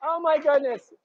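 surprised. Real, not acted. Moved by donation.

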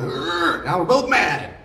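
high, laughing put-on grunt of outrage; hammed-up friend laughing along in anger; high, laughing mock outrage; mock shared anger. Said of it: "They go on".

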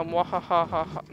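slow villain cackle; slow evil laugh. Pretend. Evil laugh while playing.

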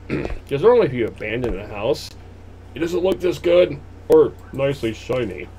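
ordinary talk

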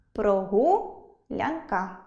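прогулянка broken as прогу — лянка; прогулянка split after the second syllable; split for line break. Shown as a demonstration. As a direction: stretch прогу upward, pause, then лянка. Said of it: "як воно ділиться на склади".

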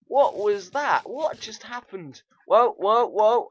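high, startled exclamations; alarmed. Real, not acted. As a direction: react in shock to a sudden attack. Startled by attack in game.

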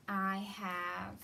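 usual speaking voice

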